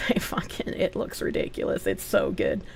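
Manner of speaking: hushed whisper. Real, not acted. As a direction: whisper it like a secret. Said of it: "hushed, secret product tease".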